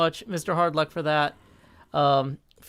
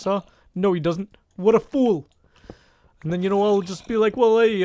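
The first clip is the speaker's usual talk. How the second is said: louder folksy cartoon-bear voice; Yogi Berra impression; 3 dB louder than usual. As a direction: ham up the bumbling bear greeting friends. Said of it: "That's an impersonation of famous".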